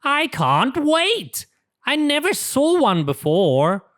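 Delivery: can't wait to see it; eager excitement. Acted. Pete tells the captain he's eager, excited. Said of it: "says Pete".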